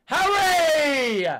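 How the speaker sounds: high, loud call of the name; loud shout. Real, not acted. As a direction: yell the name at the screen. Fan yelling a name.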